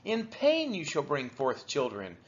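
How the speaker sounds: normal talking voice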